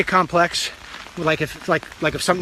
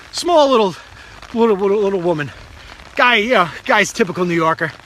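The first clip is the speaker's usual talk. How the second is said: ordinary talk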